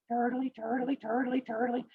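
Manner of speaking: small high chant; plodding turtle. Acted. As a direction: chant softly and high in an even rhythm. Turtle keeps running in story.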